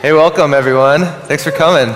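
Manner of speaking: ordinary talk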